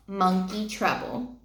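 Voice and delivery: ordinary delivery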